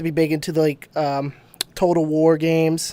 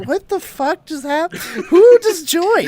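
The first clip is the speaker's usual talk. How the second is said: high, stretched-out confusion; mock bewilderment; 12 semitones higher, slower than usual. Retelling hearing an unexpected girl in chat, bewildered. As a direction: squeak it in baffled disbelief.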